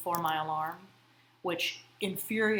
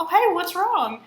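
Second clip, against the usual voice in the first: much higher, louder, cheery concern; bright startled greeting; 10 semitones higher, 10 dB louder than usual. Retold or imitated. Someone walking in on an argument, bright and startled. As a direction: burst in with bright worry. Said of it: "you're like".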